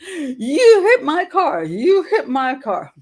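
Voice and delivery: high, slow shrieked accusation; imitating the woman yelling at her; mock screaming woman. Grandmother mimics the angry woman screaming at her. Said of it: "a woman started screaming at me".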